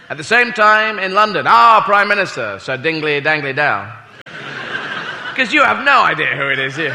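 ordinary talk, nothing marked

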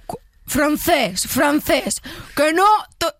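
loud, torn-voiced Galician actor; rasping impression. Contestant trying the impersonator's rougher voice, rasping. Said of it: "Un poco más rasgado, si puedes".

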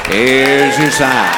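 slow drawn-out Here's your sign, your stressed; slow punchline. Acted. Comic to the audience, slow deadpan punchline. Recurring punchline delivered slowly.

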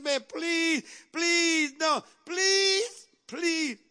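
high, tearful pleading with drawn-out pleases; desperate begging. Acted. Debtor on the ground begs his master to spare his family, desperate pleading. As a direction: beg for mercy, voice climbing toward a sob.

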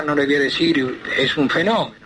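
ordinary talk

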